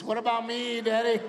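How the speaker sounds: put-on a neglected kid whining for attention; whiny son voice.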